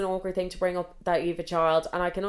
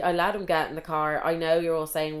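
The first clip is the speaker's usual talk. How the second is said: ordinary talk, nothing marked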